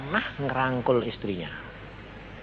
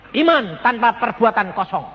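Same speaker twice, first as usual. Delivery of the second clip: higher, louder declaration; loud proclamation; 7 semitones higher, 6 dB louder than usual. Retold or imitated. Preacher loudly passing God's word to the husband. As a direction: declare it firmly. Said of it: "Aku bilang".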